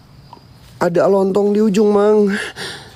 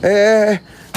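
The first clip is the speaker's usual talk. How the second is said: ordinary delivery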